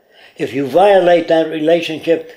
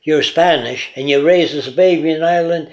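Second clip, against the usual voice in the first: ordinary talk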